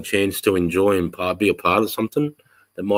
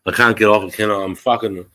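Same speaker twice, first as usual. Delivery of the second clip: ordinary delivery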